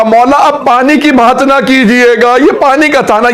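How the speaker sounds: tearful plea. Acted. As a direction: voice lifted into a crying plea to his master. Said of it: "اب جناب حر رونے لگے".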